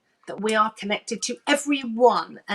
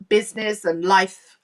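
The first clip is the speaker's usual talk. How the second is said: usual speaking voice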